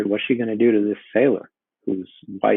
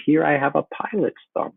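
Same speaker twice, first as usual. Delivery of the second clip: witch voice; 7 semitones higher than usual. Acted. Teacher voicing first witch.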